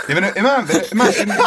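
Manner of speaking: talking through high laughter; laughing. Real, not acted. Laughing while explaining.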